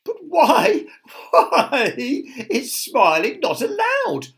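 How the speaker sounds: high, loud acted laughter; hammed-up merry laughing voice. A narrator's reading. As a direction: laugh through the question, drawn out. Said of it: "laughed little Miss Sunshine".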